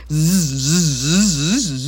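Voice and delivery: loud buzzing zzz; offended buzz. A narrator's reading. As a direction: buzz like an annoyed bee. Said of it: "zümmögött sértődötten a méhecske".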